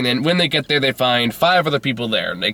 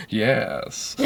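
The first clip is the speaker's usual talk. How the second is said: put-on softer, languid English-accented yes; absurd English accent; 6 dB quieter than usual. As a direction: answer with lazy hammy English charm. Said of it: "He talks like that".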